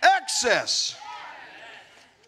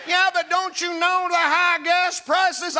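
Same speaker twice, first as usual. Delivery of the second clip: higher, louder scoffing objector; skeptic's objection; 6 semitones higher, 3 dB louder than usual. Acted. Preacher voicing a doubting churchgoer, a loud higher scoffing objection. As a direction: toss out the heckler's complaint.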